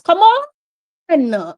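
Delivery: high, loud cry of frustration; exasperated shout. Real, not acted. Fan urging the boys on late in the match, exasperated shout.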